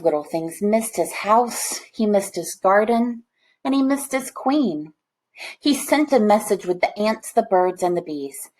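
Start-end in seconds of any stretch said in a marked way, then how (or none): none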